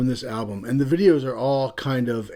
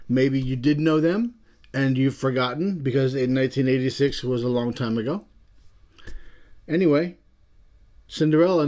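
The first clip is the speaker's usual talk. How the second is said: ordinary talk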